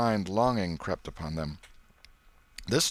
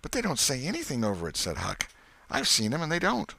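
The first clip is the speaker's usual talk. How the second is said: put-on quick, higher boyish protest; boy objecting; 6 semitones higher than usual. Huck contradicting Tom about the bread, quick high protest. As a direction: insist he's seen it himself.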